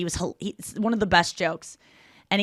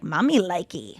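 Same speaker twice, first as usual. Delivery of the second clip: put-on playful baby talk; 3 semitones lower, 6 dB quieter, slower than usual. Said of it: "to myself, I was just like".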